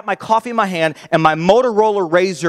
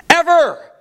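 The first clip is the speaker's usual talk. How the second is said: excited; 12 semitones higher than usual. Acted. Building up the coolest phone.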